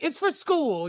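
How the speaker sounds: high-pitched imitation of a pleading kid; retold excuse to mom.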